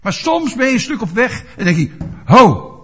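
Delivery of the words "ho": sudden halt. Retold or imitated. Inner voice stopping oneself.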